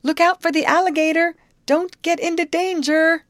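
high, loud warning shout; calling out a warning. A narrator's reading. Girl warning the youth about the alligator, loud shout. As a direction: yell the warning across the water. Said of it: "She called to him".

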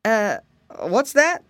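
lightly voiced startled elephant; a mild exclamation from a big creature; exclaiming elephant. A narrator's reading. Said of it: "exclaimed the elephant".

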